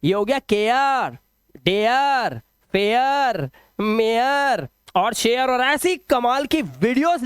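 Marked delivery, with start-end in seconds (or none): 0.4-1.2 slow demonstration of "के आर"; correct care vowel. Shown as a demonstration. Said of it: "ए का उच्चारण ए आ हो जाएगा". 1.6-3.5 "डेयर, फेयर", each ending in a stretched "e-yar"; correct ARE reading. Shown as a demonstration. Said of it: "ए का उच्चारण ए आ हो जाएगा".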